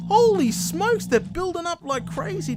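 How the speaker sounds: high, loud burst of surprise; sudden high yelp of surprise; excited exclaim. Real, not acted. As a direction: blurt it out in shock. Mobs suddenly piling up below.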